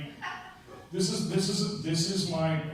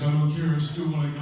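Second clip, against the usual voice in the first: louder, whiny insisting; insistent stoned guy; 3 semitones lower, 7 dB louder than usual. Retold or imitated. Tripping guy insisting on the hospital, louder and whiny. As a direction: dig in like a tripping patient.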